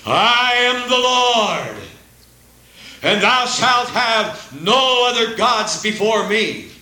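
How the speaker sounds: slow, high, booming divine proclamation; preacher voicing God giving the commandments, solemn and slow. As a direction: announce it like God speaking from the mountain. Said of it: "was when the voice of God spoke".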